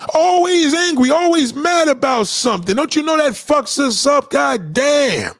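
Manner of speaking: high-pitched, worked-up complaint; exasperated rant. Real, not acted. Rant on angry families.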